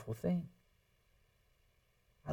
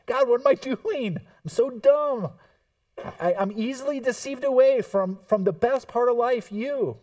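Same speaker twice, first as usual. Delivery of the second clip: higher and louder, mock frustration at himself; self-exasperated; 7 semitones higher, 4 dB louder than usual. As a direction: groan at your own foolishness. Exasperated believer quoting himself.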